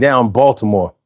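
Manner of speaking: ordinary delivery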